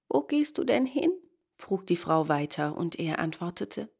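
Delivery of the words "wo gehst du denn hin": higher-pitched woman's question; lightly voiced curious ferrywoman; ferrywoman asking. A narrator's reading. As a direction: ask it in a lifted voice.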